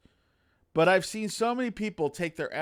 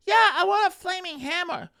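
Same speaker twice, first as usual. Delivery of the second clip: much higher, bright eager player asking for loot; 4 dB louder than usual. Pretend. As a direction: squeal it like a greedy adventurer. Player voiced wanting a weapon.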